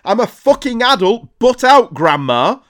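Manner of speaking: loud, high exasperated yell; angry outburst. Acted. Grown man telling his aunt to back off, angry shout. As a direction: blow up at a meddling relative.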